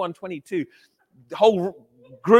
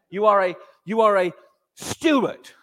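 usual speaking voice

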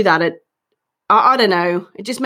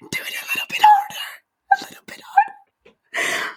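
squeaky whisper pleading up high; breathy high whispered urging; high-pitched whisper; 4 dB quieter than usual. Shown as a demonstration. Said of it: "so high-pitched whisper".

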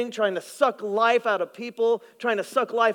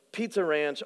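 ordinary delivery

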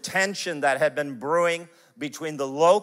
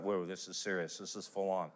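much softer, low murmur to himself; hushed aside; 7 semitones lower, 13 dB quieter than usual. Retold or imitated. Pastor recalling his reaction to the confession, hushed. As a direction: say it under your breath, stunned. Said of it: "I went".

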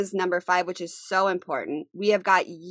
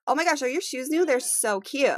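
gushing compliment; 6 semitones higher than usual. Acted. Acted example of a compliment.

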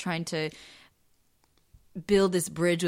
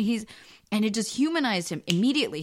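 ordinary talk, nothing marked

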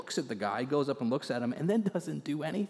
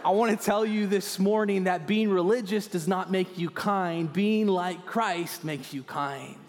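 louder, higher proclamation, slow on the last line; preaching at full force; 6 semitones higher, 5 dB louder, slower than usual. Acted. Preacher declares the sermon's key line, loud and rising. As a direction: drive the point home to the whole room.

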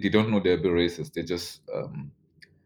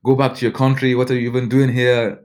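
raised, higher retold anger; angry outburst; 5 semitones higher, 4 dB louder than usual. Classmate turning on his project partner, moderated anger. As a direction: throw it out like an angry classmate, toned down. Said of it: "trying to be moderate here".

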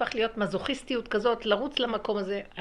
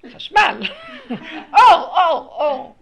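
high, very loud mock complaint, laughter breaking in; imitated child's shout; 9 semitones higher, 19 dB louder, slower than usual. Child on a chair complains about the light, imitated high shout. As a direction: call it out like a bothered toddler.

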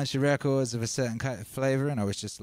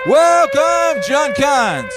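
much higher, much louder announcer call; hype welcome; 14 dB louder, much slower than usual. Acted. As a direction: belt out the welcome like a ring announcer. Hyping up a new viewer.

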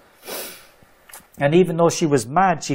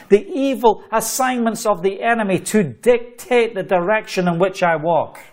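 fiery preaching; 6 semitones higher than usual. Acted. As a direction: declare it like a revival preacher building to a peak. Preaching climax, proclamation.

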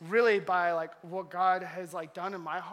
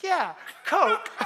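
cheerful offer; 7 semitones higher, 6 dB louder than usual. Retold or imitated. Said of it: "He's like".

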